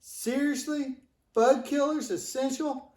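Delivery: high, disbelieving question; can't believe what he's hearing; incredulous. Acted. Incredulous at bug killers essential.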